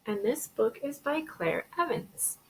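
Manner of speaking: ordinary delivery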